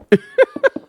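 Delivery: shrill burst of laughter; high squealing laugh. Real, not acted. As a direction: squeal with laughter at your own joke. Laughs at own joke.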